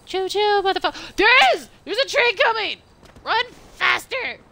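high, loud panicked yelling; being chased. Real, not acted. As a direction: panic as the train closes in. Chased by train in game.

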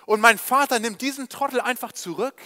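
the elder brother can't believe it, loud and indignant; shocked outrage. Acted. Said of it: "völlig schockiert". (älterer Sohn empört sich über den Vater, laut und fassungslos)

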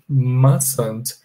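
"mustn't" slowly as MAS-unt, no t; drawn-out "mustn't" with silent t. Shown as a demonstration. Teacher slowly modelling mustn't for students. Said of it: "é como se eu não pronunciasse o T".

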